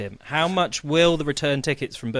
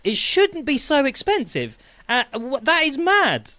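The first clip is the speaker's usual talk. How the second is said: higher-pitched outrage at the price; indignant; 9 semitones higher than usual. Real, not acted. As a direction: sound appalled at the cost.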